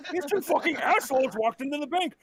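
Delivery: put-on old man's indignant complaining; cranky old man. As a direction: grouse like a fed-up ninety-year-old. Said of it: "why are you so mad".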